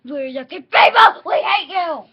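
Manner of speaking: loud, hammed-up baddie toys; mock villain toys. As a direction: sneer it like nasty toys. Villain toy voice in play.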